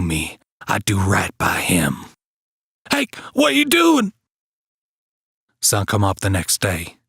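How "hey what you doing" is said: high, startled shout; alarmed cry. Acted. Joe seeing Hake raise the axe, startled shout.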